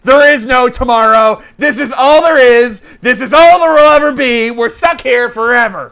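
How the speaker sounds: loud, high hammed-up doom proclamation; mock despairing rant. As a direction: rant like a passenger trapped for eternity. Acts out despairing trapped passenger.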